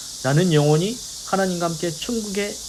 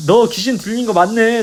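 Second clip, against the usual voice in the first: raised, higher mock yell; retold shouting; 5 semitones higher, 5 dB louder than usual. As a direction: yell the accusation as an imitation. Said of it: "소리 지르면서".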